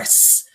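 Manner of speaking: long vampire hiss; imitated hiss. Retelling the vampire's reaction, mock hiss. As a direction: hiss like a vampire hit by a ball.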